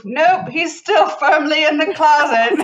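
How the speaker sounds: slow, high, loud mock mid-Atlantic; mid-Atlantic accent. Sister answers about ghost's move, slow hammed-up mid-Atlantic accent. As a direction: draw it out in old-time mid-Atlantic, projected. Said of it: "mid-Atlantic, mid-century accent thing".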